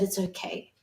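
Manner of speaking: a quiet, hushed little voice saying it's okay; soft comforting child. Retold or imitated. Mother quoting her small daughter comforting her, very softly.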